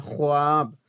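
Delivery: ख्वाब drawn out with its "w" heard; spelling pronunciation. Shown as a demonstration. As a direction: say ख्वाब as "kho-aab". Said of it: "ऐसे लिखते हैं".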